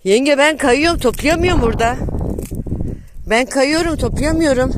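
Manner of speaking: high, loud call down the hill. Real, not acted. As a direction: shout so family below can hear. Calling to aunt down the slope.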